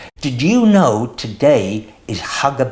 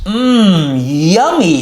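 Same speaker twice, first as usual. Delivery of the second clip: higher and louder with relish; delighted yummy; 4 semitones higher, 5 dB louder than usual. Acted. Douglas tasting the nuts and finding them delicious, louder delight. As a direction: savour the taste out loud. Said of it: "he cried".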